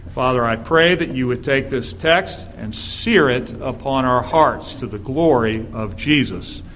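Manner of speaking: ordinary delivery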